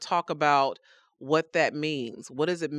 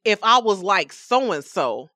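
self-righteous boast; 7 semitones higher, 6 dB louder than usual. Retold or imitated. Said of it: "how often do we say".

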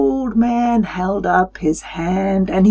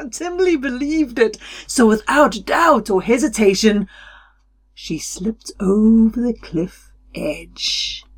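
usual speaking voice

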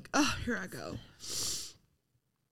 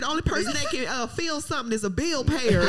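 higher, laughing impression of a scolding parent; laughing mock parent; 7 semitones higher than usual. Mocking parents who dismiss a child's feelings, laughing. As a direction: lampoon the old-school parent with a laugh. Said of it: "my parents would have been like".

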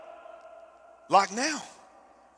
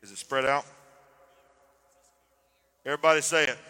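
normal talking voice

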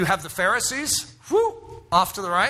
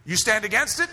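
ordinary talk

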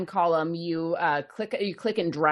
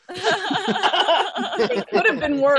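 laughing; 12 semitones higher, 4 dB louder, much slower than usual. Real, not acted. Said laughing, then repeated plainly.

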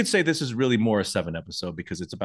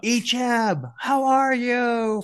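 high, drawn-out holler across a distance; mock distant yell; 13 semitones higher, much slower than usual. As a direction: imitate calling out from far away. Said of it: "Evil Knievel yells out".

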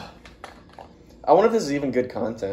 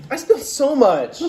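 higher, louder exclaim; 11 semitones higher, 4 dB louder than usual. Real, not acted. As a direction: stress on so.

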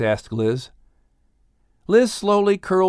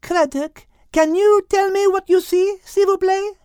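put-on high French-accented voice, calmly asking; high, poised French accent. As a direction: play Liz with a French accent, asking the whale. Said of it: "Liz calmly asked".

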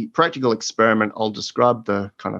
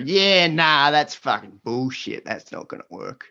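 exaggerated ocker Aussie drawl; broad Australian accent; 3 semitones higher than usual. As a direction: lay the Australian accent on thick. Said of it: "put on my best ochre accent".

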